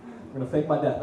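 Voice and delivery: ordinary talk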